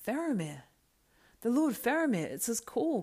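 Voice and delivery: high, loud, urgent cry; cried out. Acted. Beregond hearing his captain's trumpet, crying out. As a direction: shout the name in sudden recognition. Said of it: "cried Beregond".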